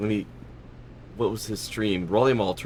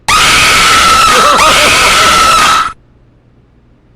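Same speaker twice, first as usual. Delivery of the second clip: loud burst of laughter; 21 dB louder than usual. Real, not acted. Sudden loud laugh at alert.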